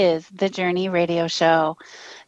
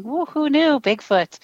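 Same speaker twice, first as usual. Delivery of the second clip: playful "woo", then drop back to usual pitch; mock cheer; 7 semitones higher than usual. Host relaying a chat room joke, mock cheer. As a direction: read out a chat message with a higher-pitched whoop. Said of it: "Chris is in the chat room saying".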